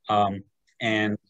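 ordinary talk, nothing marked

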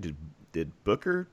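ordinary talk

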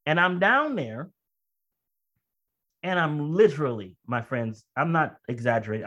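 ordinary delivery

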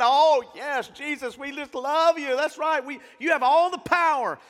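high, loud, rapturous praise; gushing worship. Retold or imitated. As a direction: gush it like a swept-up worshipper. Recalling worship song at service end.

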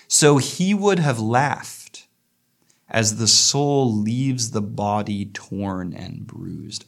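slow, measured poetry recital; poem reading. Acted. Teacher reading the poem to students, slow and measured. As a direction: read the stanza slowly, savouring each line. Rereads lines slowly.

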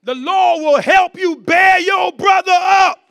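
high, loud pulpit shout; full-force preaching. Real, not acted. Preacher promising the Lord will help bear a brother, full-force shout. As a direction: shout the promise out over the congregation.

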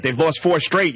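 ordinary talk, nothing marked

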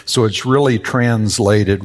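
normal talking voice

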